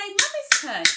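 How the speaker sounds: high, loud, sing-song announcement; demonstrated mummy-to-child voice. Shown as a demonstration. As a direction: call it out brightly to a toddler. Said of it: "you could say".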